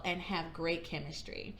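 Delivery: usual speaking voice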